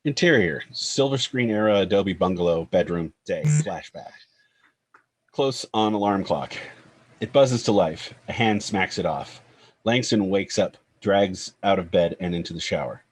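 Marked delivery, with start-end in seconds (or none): none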